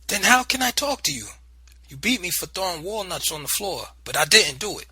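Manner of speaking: high, hurt and insistent; pleading protest. Acted. Boy protesting to aunt about beating, high and hurt.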